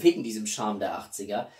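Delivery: ordinary delivery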